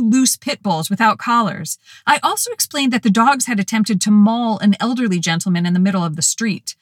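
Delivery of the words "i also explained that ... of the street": plain reading voice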